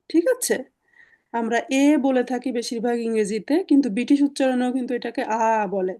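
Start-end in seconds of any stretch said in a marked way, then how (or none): none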